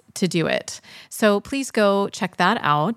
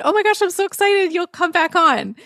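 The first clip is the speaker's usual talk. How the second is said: excited; 11 semitones higher than usual. Retold or imitated. Host retells her own excitement.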